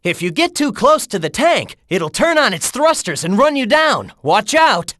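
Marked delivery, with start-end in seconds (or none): none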